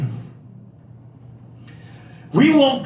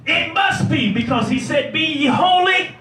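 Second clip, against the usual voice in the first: preaching at full force; 6 semitones higher than usual. Acted. Preacher lifting the congregation to the big blessings. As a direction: whoop it up like a revival preacher.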